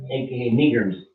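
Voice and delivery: usual speaking voice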